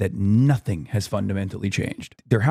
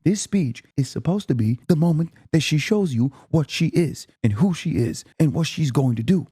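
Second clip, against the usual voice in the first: usual speaking voice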